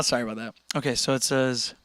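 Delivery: ordinary delivery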